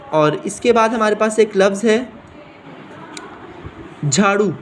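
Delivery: ordinary talk, nothing marked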